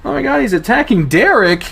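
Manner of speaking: high, loud panicked scream. Acted. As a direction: shriek the accusation. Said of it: "one of the girls screams".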